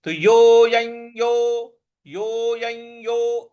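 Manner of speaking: यो को दीर्घ खींचकर; drawn-out यो. Shown as a demonstration. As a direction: pull the vowel of यो out long. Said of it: "इस प्रकार से इसको बोलना होगा".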